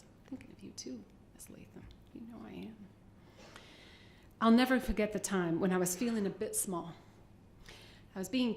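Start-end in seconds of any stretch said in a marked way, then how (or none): 0.0-2.8 soft breathy aside; whispered aside. Real, not acted. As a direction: murmur it to a friend. Aside to a friend.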